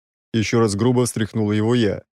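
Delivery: plain reading voice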